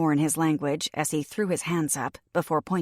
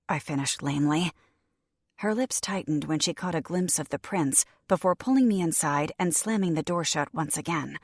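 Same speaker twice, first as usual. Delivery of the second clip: ordinary delivery, nothing marked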